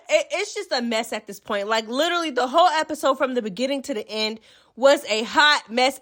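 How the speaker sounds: normal talking voice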